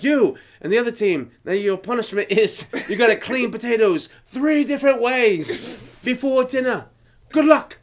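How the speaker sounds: hammed-up chef sending them off; imitated chef announcement. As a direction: announce the punishment with relish.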